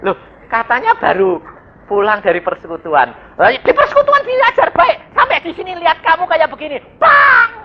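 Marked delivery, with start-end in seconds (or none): 3.4-7.6 high, fast indignant nagging; mock scolding spouse. Spouse scolding after fellowship, high indignant nag. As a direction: scold the one who just came from fellowship.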